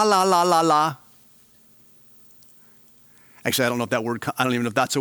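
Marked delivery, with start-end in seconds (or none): 0.0-1.0 higher, louder sing-song la la; mock a baby's babble; babbling la la. Shown as a demonstration. Preacher showing babbling like a baby, higher sing-song. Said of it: "the word from which we get our word la".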